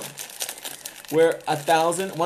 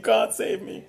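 normal talking voice

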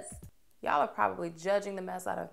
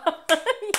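high, bright burst of giggling; high gleeful giggle; 9 semitones higher, 6 dB louder than usual. Real, not acted. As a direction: giggle at your own joke, pitch high. Laughing at her own rhyme.

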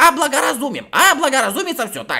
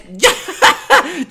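high, loud laugh; sudden high cackling laugh; burst of laughter; 4 semitones higher, 6 dB louder than usual. Real, not acted. Laughs at the game glitch.

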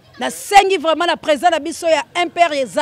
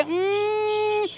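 high, sustained "hmmm"; drawn-out hum; 9 semitones higher, 4 dB quieter than usual. Real, not acted. Host savouring his praise, drawn-out hum. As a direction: hum long on one raised note.

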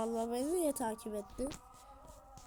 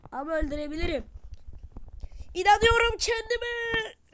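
excited cry; 10 semitones higher, 9 dB louder than usual. Real, not acted. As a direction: psych yourself up, yelling high. Low health fight.

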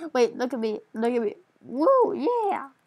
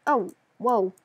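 ordinary talk, nothing marked

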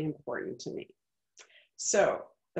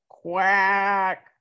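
duck's quack; 8 dB louder than usual. Acted. Duck answers.

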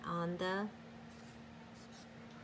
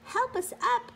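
high, louder plea from the pile; crying for help; 11 semitones higher, 4 dB louder than usual. Acted. Fallen letter A begging to be helped up, high cry. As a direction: call up desperately from the bottom of the heap. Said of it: "cried A".

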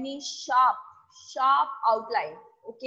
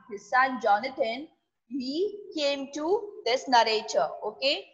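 usual speaking voice

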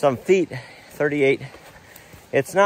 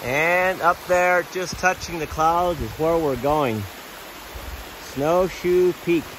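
awed reveal; 4 semitones higher, slower than usual. Real, not acted. Reveals the summit goal.

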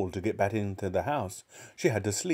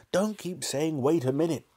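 high, exasperated cry; mild shout; 7 semitones higher than usual. A narrator's reading. Father shouts at his son for delaying, high-pitched mild shout. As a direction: raise the pitch in protest. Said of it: "shouted Mister Cranky".

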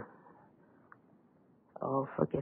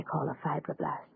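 hushed confession; 9 dB quieter than usual. Acted. Doctor confiding his secret discovery.

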